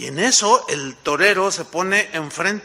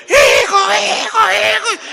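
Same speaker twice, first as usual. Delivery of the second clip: much higher, loud frightened scream; grandma screaming; 13 semitones higher, 9 dB louder than usual. Retold or imitated. Grandmother screaming for her grandson as the bull leaps, loud panic. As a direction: cry out in panic for the boy. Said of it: "escuché el grito de mi abuela".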